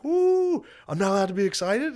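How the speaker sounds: high whoop into indignant protest; whoop, then protest in a high mock yell; over-excited player impression; imitating excitement. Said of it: "he switches from being like".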